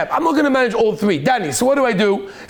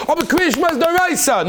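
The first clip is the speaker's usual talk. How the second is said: ordinary talk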